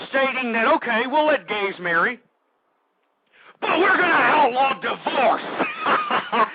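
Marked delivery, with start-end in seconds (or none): 3.6-6.5 high, drawn-out crowing punchline; gleeful announcement. Real, not acted. Radio host revealing the amendment to listeners, gleeful. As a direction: crow it slowly, about to burst out laughing.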